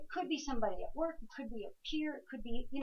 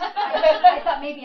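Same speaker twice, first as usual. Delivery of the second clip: laughing; 6 semitones higher, 17 dB louder, much slower than usual. Real, not acted. Medium laughing at the reaction.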